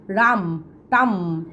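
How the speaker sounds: রাম and টাম said with a clear open "a" vowel; vowel sound shown. Shown as a demonstration. Teacher showing students the vowel in rumbling tumbling. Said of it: "ইউ এর উচ্চারণটা ... আয়ের উচ্চারণ".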